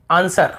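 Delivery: आंसर with the W silent; correct pronunciation. Shown as a demonstration. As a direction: say आंसर as an-sar, no W sound. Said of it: "हमें आंसर नहीं पढ़ते".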